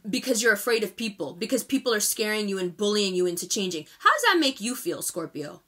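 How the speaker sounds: usual speaking voice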